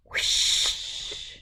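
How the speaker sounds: soft airy wind sound; wind whoosh. A narrator's reading. Reader makes the cold wind's whoosh, soft. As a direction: blow it like wind.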